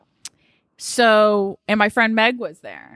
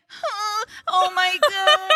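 high whimpering mock sob, drawn out; hammed-up drunk crying; mock whine crying; 12 semitones higher, slower than usual. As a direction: imitate his drunk whine, pitched way up. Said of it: "whine crying".

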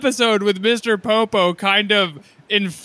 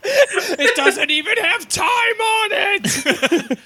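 laughing shout; 12 semitones higher, much slower than usual. Real, not acted. Laughing protest continues.

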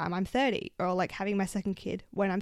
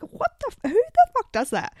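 high, loud burst of disbelief; retold outrage; 13 semitones higher, 7 dB louder than usual. Friend ditched her at the party, retold outraged outburst. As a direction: re-enact an indignant outburst, pitch shooting up. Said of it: "I got so fucking mad".

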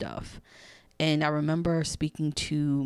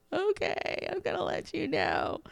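very high, squeaky mock-crying voice; hammed-up tearful surrender, pitched way up; imitated tearful reply; 13 semitones higher, 4 dB quieter than usual. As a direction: give in with a put-on weepy whine. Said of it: "I'm crying while I'm saying this".

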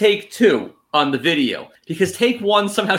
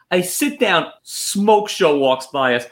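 ordinary talk

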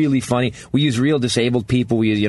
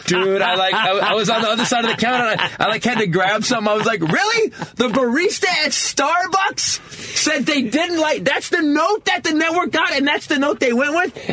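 incredulous; 14 semitones higher than usual. Real, not acted. Outraged retelling, high pitch.

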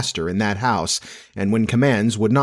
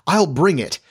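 higher, eager offer to help; eager cry; 6 semitones higher than usual. A narrator's reading. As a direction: jump in helpfully. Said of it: "always glad to be of service".

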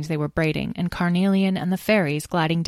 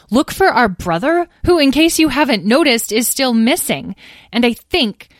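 higher, louder, pointed comeback; indignant retort; 8 semitones higher, 7 dB louder than usual. Acted. Petra telling siblings their brother is missing, indignant. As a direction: spell it out to someone oblivious.

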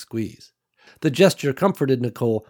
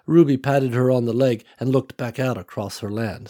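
plain reading voice

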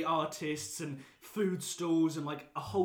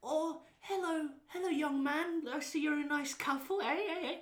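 very high, put-on wheedling voice; creepy woman's voice; 12 semitones higher than usual. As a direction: imitate an oddball stranger, pitched way up. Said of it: "She was like".

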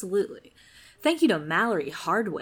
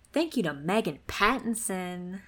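ordinary talk, nothing marked

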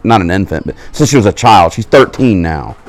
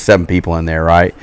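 normal talking voice

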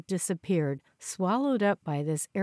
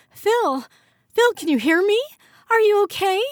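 much higher, much louder alarmed calls; frantic calling; 13 semitones higher, 9 dB louder than usual. Acted. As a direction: shout his name to reach him. Calling to collapsed friend.

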